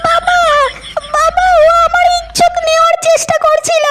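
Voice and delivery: high put-on girl's voice pleading to her father; complaining daughter. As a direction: whimper it high as the wronged daughter. Daughter accuses man to father. (বাবার কাছে নালিশ করা মেয়ের উঁচু কাতর গলা)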